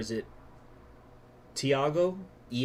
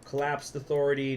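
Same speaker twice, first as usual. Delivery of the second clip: normal talking voice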